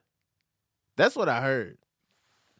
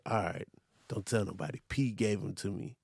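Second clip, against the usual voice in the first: hushed; 6 semitones lower, 7 dB quieter than usual. Retold or imitated. Secretive imitated reply.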